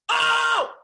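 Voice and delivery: huge high signature scream; Kinison scream. Retold or imitated. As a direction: let out a wild comic shriek. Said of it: "that's a good impression".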